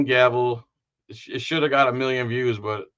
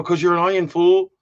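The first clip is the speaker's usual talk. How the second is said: louder, higher comeback; mock retort; 7 semitones higher, 5 dB louder than usual. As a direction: fire back in mock exasperation. His acted retort to onion.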